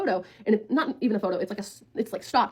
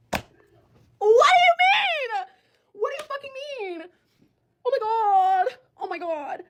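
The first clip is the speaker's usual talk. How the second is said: long, very high drawn-out cry; horrified wail; 10 semitones higher than usual. Retold or imitated. Reacting to news about the boyfriend and a teenager, horrified wail. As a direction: wail in disbelief, then let it fade. Reliving shock at the terrible news.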